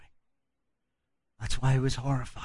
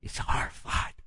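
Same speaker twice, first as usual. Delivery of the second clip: hushed whisper. Acted. Jesus confiding his horror, whispered. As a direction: breathe the words as if afraid. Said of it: "he says".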